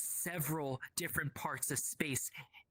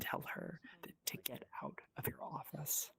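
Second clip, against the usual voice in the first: cold hushed whisper; 8 dB quieter than usual. Acted. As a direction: whisper the dismissal coldly.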